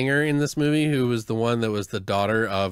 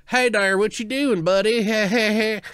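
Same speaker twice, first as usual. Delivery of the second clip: hammed-up Southern accent of a badly dubbed biker; Dale southern accent; 10 semitones higher, 4 dB louder than usual. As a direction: play a goofy Southern good old boy greeting. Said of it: "it sounds like Dale from the fucking".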